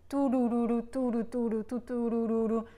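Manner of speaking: tu-du-du-du sung as grouped notes; group the tu-du-du-du in fours; grouped tonguing syllables. Shown as a demonstration. Teacher demonstrates grouping of tonguing syllables, sung. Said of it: "for all these".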